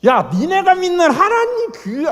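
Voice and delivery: high sneering Pharaoh voice; mocking Pharaoh. Pharaoh mocking the God of Moses, high and sneering. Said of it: "까는 소리예요".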